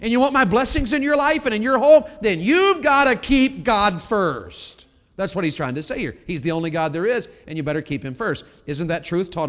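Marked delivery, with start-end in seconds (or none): 0.0-4.9 God speaking through the verse. Acted. Preacher voicing God to listeners, loud and slowed. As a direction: plead as God to his people. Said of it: "He threw this in here to say to you".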